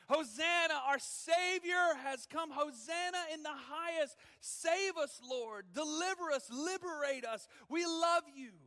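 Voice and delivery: loud, high hosanna cries; crowd shouting. Acted. Palm Sunday crowd shouting praise to Jesus, loud. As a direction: hail your king at full voice. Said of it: "the same people that are shouting".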